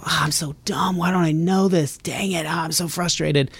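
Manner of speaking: lightly voiced frustrated person; mock frustration. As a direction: mutter like a dumb struggling person. People frustrated at math.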